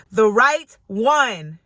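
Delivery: slow, loud call, drawn out; mock yell, stretched on each word; retold yell. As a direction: call it out after someone leaving. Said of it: "and she yells".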